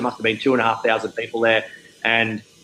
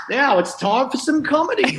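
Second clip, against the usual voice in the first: much higher, mock-cheerful announcer; chirpy MC; 14 semitones higher than usual. MC introducing comedy after a sad speech, mock-chirpy and much higher. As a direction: sell the tone-deaf cheerful intro. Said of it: "they're like".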